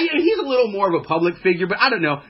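usual speaking voice

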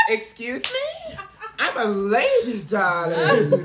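high, drawn-out mock indignation through laughter; mock offended. Teased about getting around, mock-offended lady. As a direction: play the scandalized lady, half laughing.

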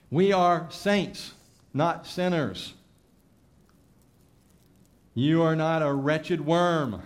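high, loud, emphatic preacher; preaching at full force. Acted. Preacher urging the congregation, full-force preaching. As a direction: drive each line home from the pulpit.

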